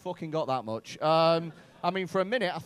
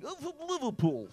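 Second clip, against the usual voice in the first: high-pitched Scouse caricature; Scouse accent; 8 semitones higher than usual. Acted. Comic imagining a Scouser in Lithuania, high mock Scouse. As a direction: squeal it as a put-on Scouser. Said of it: "there's a Scouser in Lithuania right now going".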